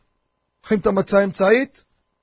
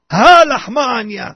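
loud, drawn-out declaration of the Haggadah line; loud proclamation; 6 dB louder, much slower than usual. Shown as a demonstration. Proclaiming over the freshly broken matzah, loud and drawn out. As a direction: proclaim it with a big stretched first syllable.

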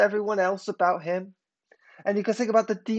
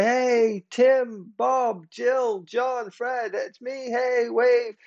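higher, drawn-out calls, name by name; mock calling across; 4 semitones higher, much slower than usual. Someone hailing friends across a concert hall, mock calling out. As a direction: pretend to hail friends over a crowd without really shouting. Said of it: "Who, who's that person shouting?".

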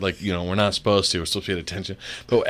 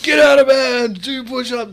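much higher, loud barked commands; drunk instructor yelling; 12 semitones higher, 4 dB louder than usual. Retold or imitated. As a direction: bark the wake-up orders at sleeping recruits. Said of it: "they're just yelling at us".

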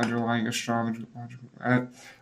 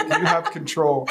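laughing; 5 semitones higher, 4 dB louder, slower than usual. Retold or imitated. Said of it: "It's like".

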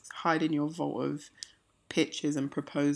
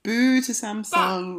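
mock boo; 5 semitones higher, 7 dB louder, much slower than usual. Jokey jeer.

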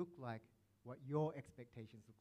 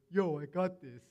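louder, swaggering "Yo, I got this"; cocky shout; 3 semitones higher, 10 dB louder than usual. Retold or imitated. Friend in a dance battle pushing past the speaker, in a loud cocky call. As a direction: call it out as you shove past. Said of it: "he pushes me out the way and goes".

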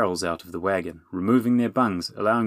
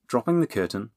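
ordinary delivery

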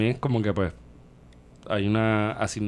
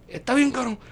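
high drawn-out quote of Tito; quoting Tito; 12 semitones higher than usual. Retold or imitated. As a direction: voice a weary rower, high. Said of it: "él te va a decir".

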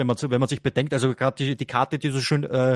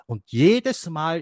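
slow, drawn-out jedes Mal; stress on jedes; much slower than usual. Shown as a demonstration. Host explaining the card to listeners, stressing jedes slowly. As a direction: hit jedes, take your time. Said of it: "die Betonung liegt ja auf jedes Mal".

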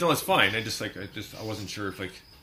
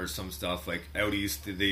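ordinary talk

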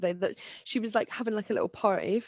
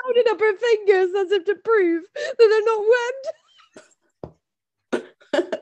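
high words through laughter; laughing; 11 semitones higher, 7 dB louder than usual. Real, not acted. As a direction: talk while cracking up.

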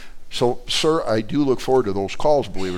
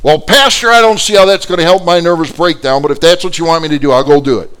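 loud, high start easing down; upset protest; 6 semitones higher, 8 dB louder than usual. Retold or imitated. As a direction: protest loudly, then grudgingly give in. Upset woman reluctantly gives in.

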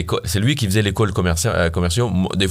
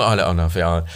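ordinary talk, nothing marked

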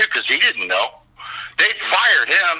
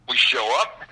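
ordinary talk

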